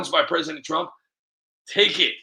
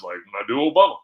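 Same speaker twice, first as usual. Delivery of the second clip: lightly voiced, lower and louder statesman; Obama; 5 semitones lower, 5 dB louder, faster than usual. Shown as a demonstration. Comic showing his one impression, lightly voiced Obama. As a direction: slip briefly into a low, louder presidential impression. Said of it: "The only one that I do is".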